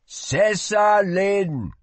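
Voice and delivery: high, slow, loud hawking; urging market cry. A narrator's reading. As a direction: urge passersby to buy. Merchant calls out.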